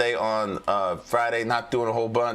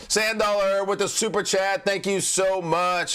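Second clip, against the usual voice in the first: excited thanks; 9 semitones higher than usual. Real, not acted. Thanking a super chat donor.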